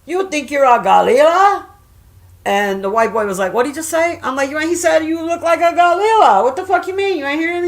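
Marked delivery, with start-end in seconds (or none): 0.1-1.6 put-on high, loud taunt in a thick Spanish accent; Ruben. Imitating her friend mocking a man, thick Spanish accent. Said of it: "he spoke with a real thick accent". 3.5-4.2 lightly voiced high, puzzled question; confused listener. Woman imitating the baffled man asking her, puzzled. 4.5-7.7 high, incredulous retort; exasperated. Retold or imitated. Woman re-enacting her reply to the confused man, exasperated.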